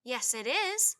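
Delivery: sure of himself and loud; confident. A narrator's reading. Tad insists to Jack that the treehouse is his, confident and loud. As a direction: insist like a cocky little kid. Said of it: "the boy said confidently".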